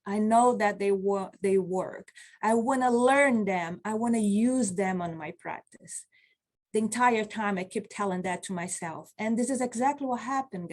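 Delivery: ordinary talk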